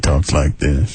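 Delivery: very low, slow, relaxed drawl; Doctor Booth. Retold or imitated. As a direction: talk like an utterly unflustered doctor. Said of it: "He's just very relaxed".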